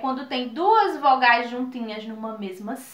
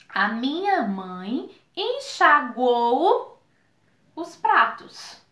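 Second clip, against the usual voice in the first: ditado lento e claro; frase bem devagar; slower than usual. Shown as a demonstration. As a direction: diga a frase devagar para os alunos. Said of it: "se eu disser assim pra vocês". (professora ditando devagar)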